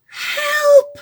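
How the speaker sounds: high cry then hushed alarm; cry for help. Acted. Girl's basket stolen, cry then hushed alarm. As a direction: shout help, then gasp in dismay.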